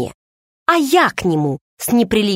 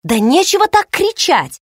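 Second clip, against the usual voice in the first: snapping back; 11 semitones higher, 3 dB louder than usual. A narrator's reading. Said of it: "одёрнула его я".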